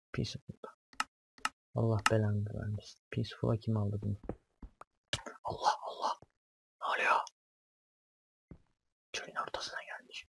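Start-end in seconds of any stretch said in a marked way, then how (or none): 0.1-0.7 breathy unvoiced whisper of the word; whispered repeat. Real, not acted. Checks difficulty, repeats it. 5.4-7.2 whisper. Real, not acted. Puzzled aside to himself. 9.1-10.3 whisper. Real, not acted. Puzzled aside to himself.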